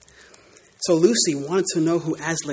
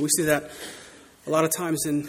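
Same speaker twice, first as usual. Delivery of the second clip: ordinary delivery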